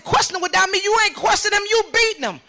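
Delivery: high, loud re-enacted accusation; outraged retort. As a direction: throw his words back in outrage. Mother arguing with detective.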